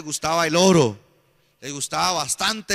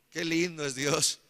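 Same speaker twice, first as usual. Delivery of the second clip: normal talking voice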